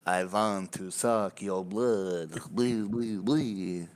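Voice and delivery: slow, drawn-out vampire voice; hammy vampire. Acted. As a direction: play a cartoon vampire, stretching each word. Vampire line in a told joke.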